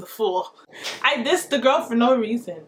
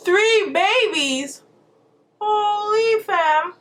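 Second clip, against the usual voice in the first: slow, higher, louder disbelief; stunned; 9 semitones higher, 3 dB louder, much slower than usual. Real, not acted. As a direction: drag it out in shock. Reacts to triplets news.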